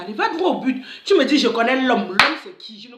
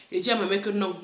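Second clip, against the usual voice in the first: usual speaking voice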